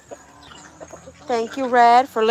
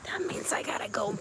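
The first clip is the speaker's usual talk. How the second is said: whisper; 3 semitones lower, 9 dB quieter, faster than usual. Real, not acted. Quiet aside before herding chickens.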